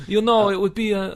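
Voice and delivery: put-on high, loud start of a hammy German accent; bad German accent. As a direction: launch into a cheesy German accent and stop. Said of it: "bad German accent".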